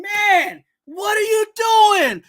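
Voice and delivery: high, loud catchphrase holler; drawn-out, performed fed-up shout; exasperated yell. Acted. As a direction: hurl it at the coach in disbelief. Segment rant at the coach.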